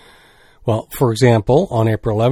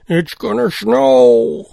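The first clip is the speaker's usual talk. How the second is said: slow mock gloom; 10 semitones higher, much slower than usual. Joking forecast after Canoecopia.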